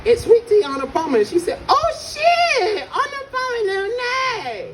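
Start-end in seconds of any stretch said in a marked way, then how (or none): 0.0-1.2 high mock Chinese-accented voice. Another server explains the drink, high mock Chinese accent. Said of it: "I'm so good at that accent". 1.7-4.6 very high, squealed mock Chinese accent; hammed-up Chinese-accented outburst in falsetto. Server finally understands the order, squealing in mock Chinese accent. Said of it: "I'm so good at that accent".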